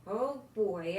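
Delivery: ordinary delivery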